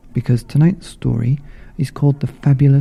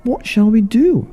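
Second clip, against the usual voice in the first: high, worried wondering; high puzzled question; puzzled frogs; 10 semitones higher than usual. Acted.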